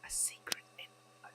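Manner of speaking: hushed sneaking whisper. Acted. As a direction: breathe the words, sneaking around. Sneaking through a dark house.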